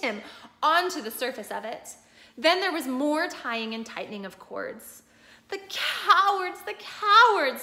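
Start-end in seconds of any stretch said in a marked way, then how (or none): 5.5-7.6 higher, slow tearful cry; sobbing. A narrator's reading. Susan sobbing at the cowards, higher and slow. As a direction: sob the word out twice. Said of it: "sobbed Susan".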